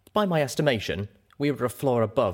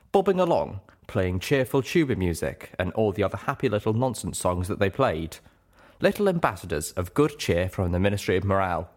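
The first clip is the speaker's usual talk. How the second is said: ordinary delivery, nothing marked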